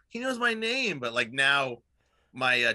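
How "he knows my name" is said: put-on kid voice. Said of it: "the little kid's like".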